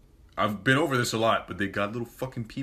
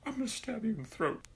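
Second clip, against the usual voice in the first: much higher, strained quiet threat; mock menacing threat; 13 semitones higher, 6 dB quieter, faster than usual. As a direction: hiss a pretend death threat through a squeak.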